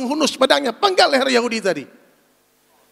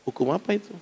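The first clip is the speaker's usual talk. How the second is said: dismissive brush-off; 8 semitones lower, 8 dB quieter than usual. Retold or imitated.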